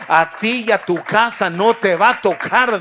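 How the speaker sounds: God's promise thundered out to the faithful king; preached shout. Acted. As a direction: proclaim it at full force.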